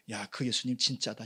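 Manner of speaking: usual speaking voice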